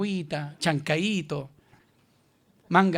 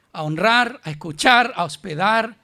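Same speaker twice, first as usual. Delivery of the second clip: higher and louder, full-force preaching; fervent sermon shout; 5 semitones higher, 8 dB louder than usual. Real, not acted. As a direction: cry it out to the congregation. Preacher building to climax.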